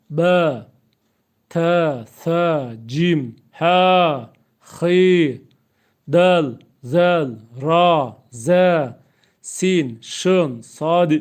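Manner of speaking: each Arabic letter called out loud and apart, with its articulation point. Shown as a demonstration. Teacher loudly recites the alphabet, each letter from its articulation point. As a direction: sound out every letter loudly with a pause after each. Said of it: "Özellikle mahreçli olarak okuyorum".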